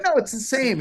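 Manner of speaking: lightly voiced rock star insisting. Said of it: "He's like".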